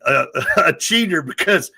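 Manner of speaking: ordinary talk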